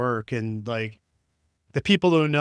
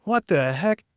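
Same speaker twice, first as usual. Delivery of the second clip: shocked exclamation; 6 semitones higher, 4 dB louder than usual. Retold or imitated. Walked in on by girl's mom.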